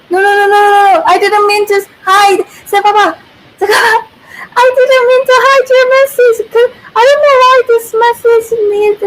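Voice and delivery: high, loud and rushed "no, no, no"; flustered, pleading, almost laughing; flustered protest. Real, not acted. Streamer apologizing to a viewer for a hidden message, high and flustered.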